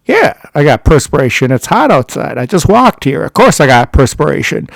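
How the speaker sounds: acted-out defensive comeback. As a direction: imitate someone justifying himself. Retold defensive comeback about sweating.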